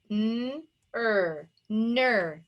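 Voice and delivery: hummed "n", then "er", then blended "ner"; segmented phonics demo of ner; tapped out. Shown as a demonstration. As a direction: sound out N, ER separately, then join as NER. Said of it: "Tap it out".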